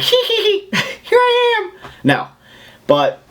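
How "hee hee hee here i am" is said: very high, slow, cackling little creature; giggling shoulder gremlin. Pretend. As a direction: giggle like a mischievous imp popping out. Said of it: "the shoulder guy was like".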